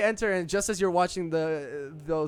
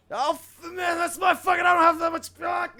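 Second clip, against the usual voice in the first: furious patron shout; 9 dB louder than usual. Acted. Patrons keep shouting at each other.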